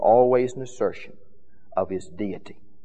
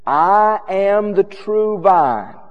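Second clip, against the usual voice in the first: Jesus's claims; 5 semitones higher, 5 dB louder, much slower than usual. Acted. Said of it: "let me give you some of the claims".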